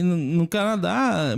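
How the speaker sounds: ordinary talk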